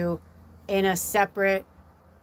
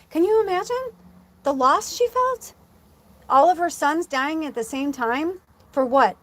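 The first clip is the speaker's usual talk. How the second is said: emotional; 8 semitones higher, 4 dB louder than usual. Real, not acted. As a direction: voice rising high and breaking. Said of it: "It's starting to make me really emotional".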